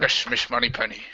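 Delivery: put-on Scottish film-star accent; Sean Connery. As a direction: purr it as a mock Scottish Bond. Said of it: "That's accent is Sean Connery".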